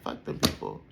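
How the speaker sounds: a low hushed hint of the blunt auntie; imitating auntie. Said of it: "this is verbatim what she would say".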